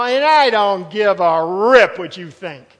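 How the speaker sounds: loud, slow, defiant brush-off; belligerent attitude. Pretend. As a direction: sneer it out like a rebel who doesn't care. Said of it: "he's not saying it like this".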